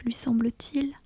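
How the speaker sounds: ordinary delivery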